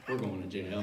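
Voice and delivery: very low, soft defeat; resigned murmur. Retold or imitated. Friend turning to me about jail, quietly resigned. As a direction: drop it low, all hope gone.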